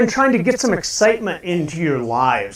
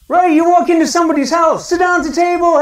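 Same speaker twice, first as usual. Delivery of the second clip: usual speaking voice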